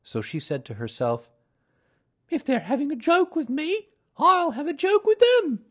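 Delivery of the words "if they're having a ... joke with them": very high, loud mother kangaroo; high-pitched scheming mother animal; Kanga's voice. A narrator's reading. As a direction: resolve it to yourself in a high character voice.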